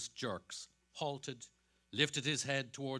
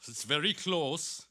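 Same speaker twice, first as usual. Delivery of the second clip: higher, louder constable voice; constable's remark; 5 semitones higher, 7 dB louder than usual. Acted. Constable remarking on the weather to Kelleher, louder. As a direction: beat policeman pressing the small talk.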